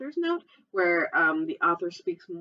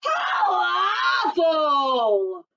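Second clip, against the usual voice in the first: very slow, loud high cry; roar; 8 semitones higher, 6 dB louder, much slower than usual. Acted. Polar bear queen hearing the news, mock roar. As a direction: drag it out in mock horror. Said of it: "roared the polar, polar bear queen".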